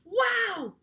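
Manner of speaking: high excited wow; shouted wow. A narrator's reading. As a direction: cheer the rescue. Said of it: "we all shouted".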